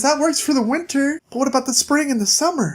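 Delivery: high, fretful princess voice; worried princess, pitched well up; Sleeping Beauty voice. Acted. Sleeping Beauty objects to Bigby's gloves idea, high and fretful. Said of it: "She says".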